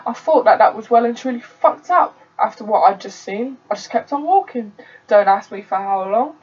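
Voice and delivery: plain reading voice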